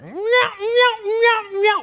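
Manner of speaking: four high, raspy imitated box-call notes; raspy turkey yelp. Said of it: "I hear the raspiest yelp".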